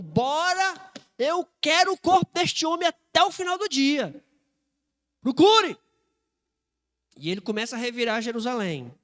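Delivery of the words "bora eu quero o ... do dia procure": raised, higher commanding voice; Pilate's urgent order. Acted. Pilate hounding the centurion to find the body, urgent order. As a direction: act out an impatient governor's command.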